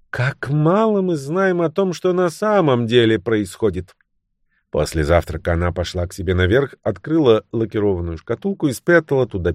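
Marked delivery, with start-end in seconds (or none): 0.1-3.9 sagely knowing. A narrator's reading. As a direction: draw it out like a wise insider. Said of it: "с мудрым видом покачивая головой". (многозначительно, с мудрым видом)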